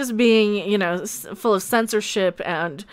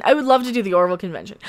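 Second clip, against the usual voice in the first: normal talking voice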